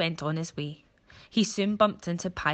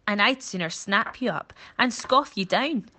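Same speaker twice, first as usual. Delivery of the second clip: louder, threatening boast; snappy crocodile; 4 semitones higher, 6 dB louder than usual. Acted. Crocodile threatens the monkey, a loud relishing boast. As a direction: relish the threat of eating him. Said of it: "said Crocodile".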